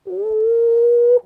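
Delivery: very high, loud, sustained "ooh"; delighted squeal. Real, not acted. Delight at the soft yarn.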